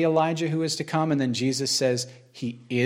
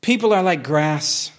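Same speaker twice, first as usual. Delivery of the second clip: slow, solemn closing reading; scripture reading; slower than usual. Acted. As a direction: draw out the last line as a promise.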